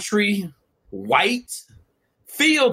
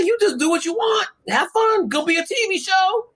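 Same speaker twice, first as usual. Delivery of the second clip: high squeaky voice, laughing through it; squeaky little executive; 12 semitones higher than usual. Retold or imitated. Executive telling Jake why he was hired, squeaky and amused. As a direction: talk up high and tickled, like the little boss. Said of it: "here come little squeaky dude".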